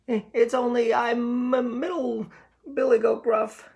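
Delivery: very high, stammering goat; shaking with fear. Acted. Middle goat answering the troll, high and stammering with fear. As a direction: stutter it out, terrified. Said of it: "shook so hard he almost fell down".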